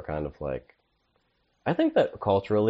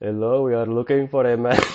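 mock Indian accent, higher and louder; 5 semitones higher, 5 dB louder than usual. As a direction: play a police officer with an Indian accent. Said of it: "Go ahead and do that character".

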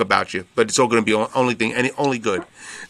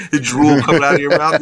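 ordinary talk

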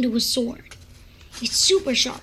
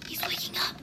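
whispering; 11 dB quieter, faster than usual. Acted. Teacher stirring, hushed warning.

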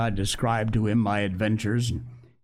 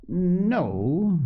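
drawn-out, higher musing note; 6 semitones higher than usual. A narrator's reading. Doctor weighs the cook's demand, musingly. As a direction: mull it over on the word. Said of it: "said the doctor musingly".

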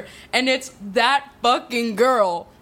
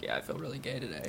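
murmured diary line, soft and quick; soft, fast self-mocking quote; quiet aside; 8 semitones lower, 14 dB quieter, faster than usual. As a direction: toss it off under the breath. Mocking diary checklist entry.

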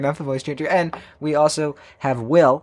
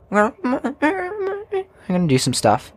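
silly high voice; slower than usual. Pretend.

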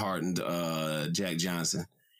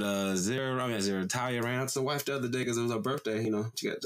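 ordinary talk, nothing marked

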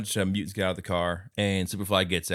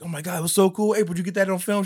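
excited turtle; 9 semitones higher, 4 dB louder, faster than usual. Retold or imitated. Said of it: "you're like".

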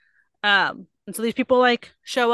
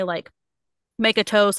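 ordinary talk, nothing marked

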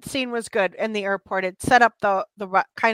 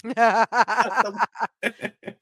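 laugh. Real, not acted. Laughing at the movie talk.

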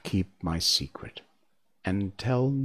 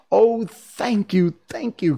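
grateful cry; 11 semitones higher, 6 dB louder than usual. A narrator's reading. Scarecrow thanks the wizard for promised brains, crying out gratefully. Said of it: "cried the Scarecrow".

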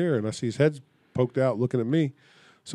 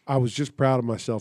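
ordinary delivery

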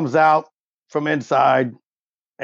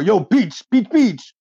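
rising dog-calling shout; 7 semitones higher than usual. Acted. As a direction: yell for the dog to come over. Calling the little dog over.

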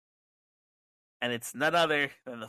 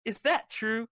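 put-on high, excited kids'-show host; Bray Wyatt; 8 semitones higher, slower than usual. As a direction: ask the puppet with raised, excited wonder. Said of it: "with excitement and he says".

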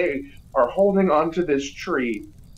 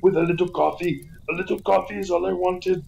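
Arnold Schwarzenegger; 3 semitones higher, faster than usual. Acted. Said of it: "Arnold's about to take a big fat burp".

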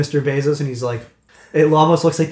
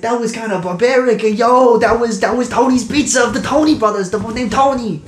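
high, loud disapproving Gonzo; 9 semitones higher, 5 dB louder than usual. Acted. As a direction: scold the brutality. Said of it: "He's like".

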